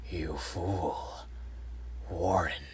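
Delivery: hollow, slow, whispery inhuman voice; deep unearthly voice. A narrator's reading. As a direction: intone it slowly like a disembodied thing. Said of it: "the voice was deep, hollow, gelatinous, remote, unearthly".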